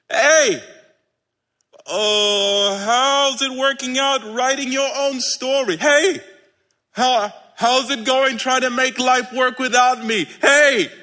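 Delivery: high, stretched-out teasing holler; calling out. Acted. Jesus needling people who go it alone, calling out. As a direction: keep hailing them from a distance.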